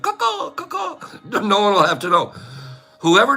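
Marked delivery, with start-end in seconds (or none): 0.0-1.0 much higher, softer caw-caw; quiet crow caw. Shown as a demonstration. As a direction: caw under your breath. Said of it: "you can do it quiet".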